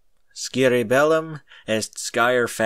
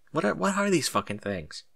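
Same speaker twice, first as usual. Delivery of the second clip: plain reading voice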